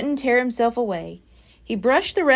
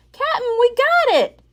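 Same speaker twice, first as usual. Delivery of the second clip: high, loud pirate shout; Pinky shouting to the captain; 13 semitones higher, 5 dB louder than usual. A narrator's reading. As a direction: yell the good news up the beach. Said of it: "shouted Pinky".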